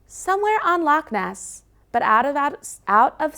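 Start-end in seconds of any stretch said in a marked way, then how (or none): none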